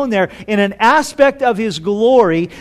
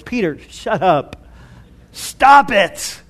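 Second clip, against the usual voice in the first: mock divine rebuke; slower than usual. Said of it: "Peter being rebuked by the Heavenly Father".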